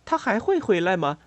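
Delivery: high, anxious question; mildly uneasy. A narrator's reading. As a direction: ask with a touch of worry. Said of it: "菲比不安地问". (略带不安地问)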